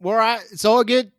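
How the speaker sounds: hammed-up sign-off; mock caller hanging up; crude caller. Caller signs off.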